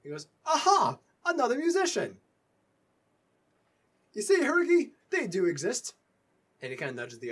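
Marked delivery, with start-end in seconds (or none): 0.4-5.9 very high, loud delighted exclamation; overjoyed dwarf bard; thrilled dwarf bard. Acted. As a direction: shout it like you found a unicorn. GM voices excited dwarf bard.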